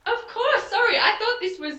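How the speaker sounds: laughing apology. Acted. Pirate apologizing to the captain with a laugh. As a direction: laugh it off breezily.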